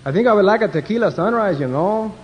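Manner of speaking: hammed-up Mexican accent. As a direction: play a Mexican passenger asking for a drink. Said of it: "the one Mexican said".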